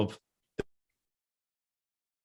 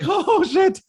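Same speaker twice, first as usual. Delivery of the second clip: much higher, louder panicked "oh, shit" through a laugh; 6 dB louder than usual. Retold or imitated. New hire panicking after the boss says he'd better be worth it. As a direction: squeak it out in alarm, laughing. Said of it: "I was like".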